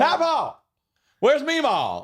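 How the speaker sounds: high Southern twang; mock Southern accent. Joins in mocking Southern family.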